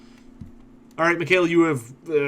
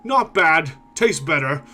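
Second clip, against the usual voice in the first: slow, louder ogre menace; 3 semitones higher, 4 dB louder, slower than usual. Acted. Ogre looming over the little dwarf, slow mocking menace. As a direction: savour the threat like a hungry brute. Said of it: "shrugs for a moment and says".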